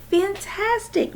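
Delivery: high, slow, emotional and choked. Acted. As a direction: barely get the word out. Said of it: "so emotional she could barely talk".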